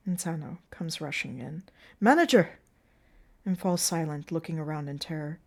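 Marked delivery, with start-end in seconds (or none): 2.0-2.6 loud, high cry out; urgent call. Acted. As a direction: yell it as you burst in. Insano rushing in, calling.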